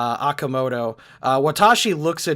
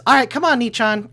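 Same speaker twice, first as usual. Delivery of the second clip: high, louder sing-song character voice; chirpy coaxing; 8 semitones higher, 5 dB louder, faster than usual. Acted. Watashi leads Scarlet off to the brig, high and coaxing. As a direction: coax them along, pitched right up.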